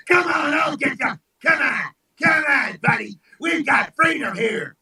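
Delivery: high-pitched, goading little challenger; feisty Mississippi. Acted. Mississippi challenging the big states from down there, feisty. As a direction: egg them on like a scrappy underdog. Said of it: "Mississippi down there talking about".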